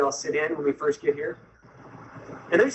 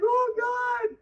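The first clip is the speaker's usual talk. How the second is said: high, shouted mortified groan; embarrassed dismay. Retold or imitated. Newcomer as the room cheers, mortified.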